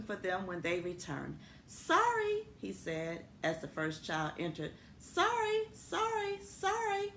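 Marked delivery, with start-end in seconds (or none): 1.7-2.5 high, sheepish little school voice; sorry school. Acted. The school apologizing to returning children, high and sheepish. 5.0-7.1 high, sheepish little school voice; sorry school. Acted. The school apologizing to returning children, high and sheepish.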